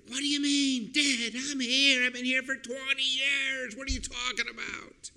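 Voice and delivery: high-pitched, incredulous mock outcry; hammed-up high wail of indignation, fading at the end; imagined crying out. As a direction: protest in exasperated disbelief. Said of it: "he'd want to cry out".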